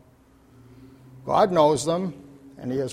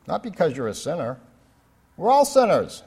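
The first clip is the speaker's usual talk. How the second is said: ordinary talk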